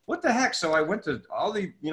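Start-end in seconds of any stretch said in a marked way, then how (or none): 0.0-0.5 high bewildered outburst; a young boy's exasperated question; baffled kid. Retold or imitated. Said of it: "So I'm like".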